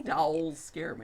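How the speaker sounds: slow Midwest accent. Real, not acted. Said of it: "your Midwest accent comes through".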